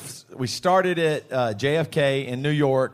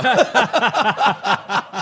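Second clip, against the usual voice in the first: burst of laughter; 6 semitones higher, 6 dB louder than usual. Real, not acted. Laughs at own story.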